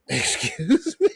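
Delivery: slow, high-pitched incredulous question; hammed-up disbelief breaking into laughter; mock offended. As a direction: draw it out as if affronted. Acting offended reply to therapist.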